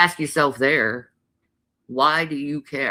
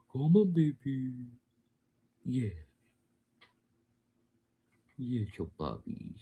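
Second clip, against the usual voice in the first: tender coaxing; 6 dB quieter than usual. Real, not acted. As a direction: gently call the puppy over. Softly calling the dog.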